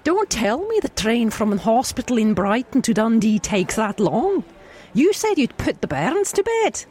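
a Scottish wife nagging her late husband. Acted. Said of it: "can I do a Scottish?".

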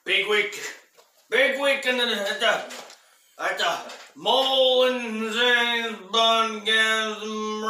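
loud, bright newscaster read; news anchor voice. Acted. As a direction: sell it like a TV anchor. Hosts a mock news show.

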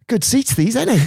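high-pitched, chummy Scouser impression; mock Scouse, pitched well up; Scouse fan. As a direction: play an oblivious, pleased Scouse punter. Scouser acted, chatting obliviously.

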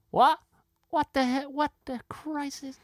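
high, dragged-out bewildered what; baffled recruits. Retold or imitated. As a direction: gawp at the stranger on stage. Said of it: "looking up over their glasses going".

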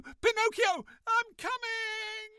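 calling out. Acted. Geppetto shouting after his son.

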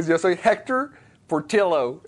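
"Portillo" said por-TIL-oh, with an l; anglicized name. Shown as a demonstration. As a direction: slowly say "Hector Portillo" the English way, l sound in Portillo. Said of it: "como, como italiano".

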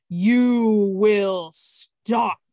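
booming command. A narrator's reading. Mountain spirit warning the volcano kids, booming. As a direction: boom it out like a mountain. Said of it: "Luvo's voice boomed from every pebble".

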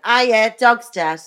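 imitating a breezy nan, high and loud; blunt nan. Nan phoning her granddaughter, chirpy and loud. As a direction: toss off bad news like gran. Nan voiced breaking the news.

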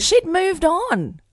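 high, slow, drawn-out reveal; dramatic reveal. Real, not acted. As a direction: stretch it out for drama.

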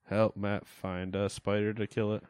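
normal talking voice

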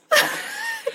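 squealing giggle; high-pitched laugh. Real, not acted. Cracks up at the answer.

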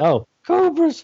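high, shouted Homer impression; Homer Simpson. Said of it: "Homer Simpson says it".

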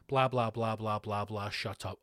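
low, trailing dismissive drone; bored dismissal. Acted. Comic cuts short a dull article, bored dismissal.

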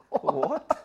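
high, loud startled "What?"; shocked what. Retold or imitated. Retelling the homeless guy's sauce request, a loud, high, startled "What?". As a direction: blurt it out in laughing disbelief.

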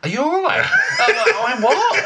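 high, slow, laughing shout of confusion; bewildered shout. Retold or imitated. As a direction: blurt out a baffled what through laughter. Mock bewildered shout retelling.

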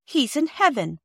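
put-on shocked child insisting on heaven. A narrator's reading. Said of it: "said Phyllis in a shocked tone".

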